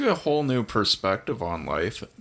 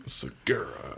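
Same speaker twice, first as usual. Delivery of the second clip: low mock name; 9 semitones lower, slower than usual. Friends repeat the name.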